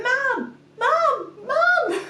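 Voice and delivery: high, loud call for Mum; imitated toddler yell. Toddler daughter standing by mum's bed, yelling for her.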